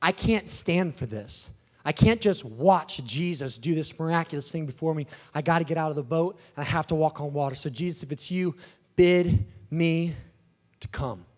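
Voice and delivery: low, hushed earnest resolve; resolute Peter. Acted. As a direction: Peter quietly pleading to be called. Plays Peter resolving to step out.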